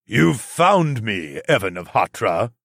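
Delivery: slow, booming demon king voice; booming demon lord. Acted. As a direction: boom it out like a giant demon. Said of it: "the Demon King's booming voice asked".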